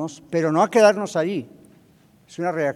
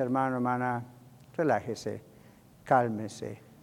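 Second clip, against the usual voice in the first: soothing; 5 semitones lower, 8 dB quieter than usual. Acted. Church member calming a discouraged brother, soothing. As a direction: gently talk them down, with pauses. Someone comes to calm the upset.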